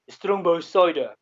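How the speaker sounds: slow, drawn-out "Strongbow cider" in a mock South African accent. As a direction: imitate the bartender aping a South African accent, slowly. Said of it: "I don't speak like that".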